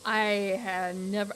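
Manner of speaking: ordinary talk, nothing marked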